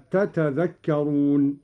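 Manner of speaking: تتذكرون with both ta syllables sounded; original two-ta form. Shown as a demonstration. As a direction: slowly recite تتذكرون as tatadhakkarun. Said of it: "وأصلها".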